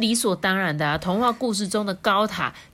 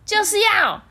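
stubborn princess; 14 semitones higher, 5 dB louder than usual. Acted. 顶嘴公主坚持.

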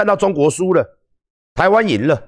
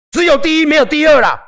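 mock zealot; 11 semitones higher, 5 dB louder than usual. Mocking the winner-only mindset.

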